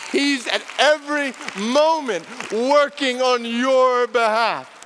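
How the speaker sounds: slow, building sermon proclamation; rising preaching. Acted. Preacher rallying Christians facing trials, slow and building. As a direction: swell it up to full preaching force.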